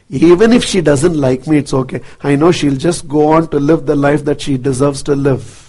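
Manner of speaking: low, soft, accepting; quiet resignation. Real, not acted. Speaker on a follower who may dislike them, quietly accepting. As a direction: sink low and soft, letting her go.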